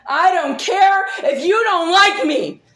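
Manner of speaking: loud shout with a laugh in it; angry laughing shout. Real, not acted. Telling the accuser she doesn't care, laughing angrily.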